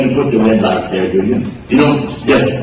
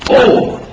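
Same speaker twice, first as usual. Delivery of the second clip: mock complaining oh.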